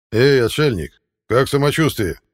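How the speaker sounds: loud jeering call through a door; mocking shout.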